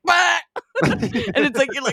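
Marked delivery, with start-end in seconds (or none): 0.1-0.4 high, loud gag sound; mock retch. Imitating Megan retching, high loud mock gag. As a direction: bark out a retching noise. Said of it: "cuts to Melissa McCarthy being like".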